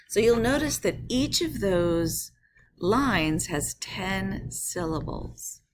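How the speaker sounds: normal talking voice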